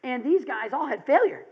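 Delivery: ordinary delivery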